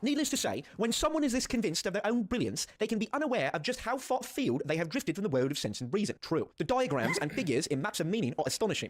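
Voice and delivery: hammed-up amateur British accent. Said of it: "I can't do it in a British accent".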